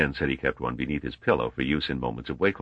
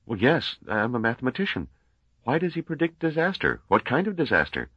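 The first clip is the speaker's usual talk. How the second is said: higher, lightly voiced young man, polite; Gale's voice; 7 semitones higher than usual. A narrator's reading. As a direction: lift the pitch for earnest young Gale. Narrator voices Gale.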